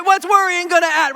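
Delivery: loud, high-pitched mock challenge; hammed-up dismissive uncle; exasperated uncle. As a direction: scoff like a tactless uncle. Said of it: "your mom just told your uncle".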